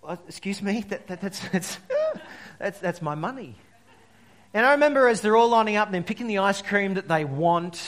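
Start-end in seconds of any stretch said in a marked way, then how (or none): 0.0-3.5 lightly voiced young kid stammering in protest; indignant young kid. Young kid objecting to nan spending the money, lightly indignant stammer. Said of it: "as a young kid just going".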